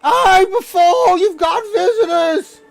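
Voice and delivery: high goblin shout, slow on the call; goblin yelling. Acted. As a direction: bellow through the door like a shrill goblin. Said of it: "he's like".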